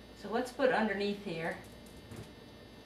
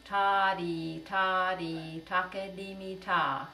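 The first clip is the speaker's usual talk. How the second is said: rhythm syllables spoken for echoing; call rhythm; much slower than usual. Shown as a demonstration. Teacher gives a rhythm for students to echo. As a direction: say the pattern clearly for them to copy. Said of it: "I'll say a rhythm and you say it back".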